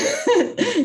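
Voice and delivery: a bright, high burst of real laughter. Real, not acted. Laughing at her little office dog, a high real laugh.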